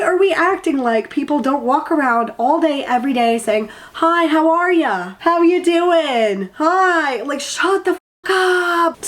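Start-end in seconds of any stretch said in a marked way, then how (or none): 3.9-7.2 high, sing-song parody of small talk; drawn-out, exaggerated hellos, making fun of pleasantries; mock cheery greeting. Mocking people's everyday greetings. 7.2-9.0 high, drawn-out exasperated outburst; fired up. Real, not acted. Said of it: "I'm extra fired up today".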